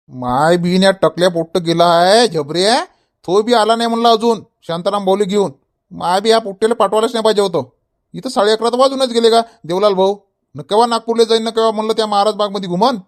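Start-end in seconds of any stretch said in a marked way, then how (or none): none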